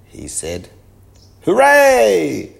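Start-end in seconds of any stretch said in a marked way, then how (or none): 1.4-2.5 very high, loud crowd cheer; shouted. A narrator's reading. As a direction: cheer at full voice. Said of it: "shouted the people".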